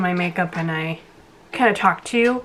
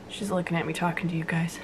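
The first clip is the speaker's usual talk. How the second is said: whisper; 3 semitones lower, 9 dB quieter than usual. Real, not acted. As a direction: whisper it under your breath. Whispered aside about baby.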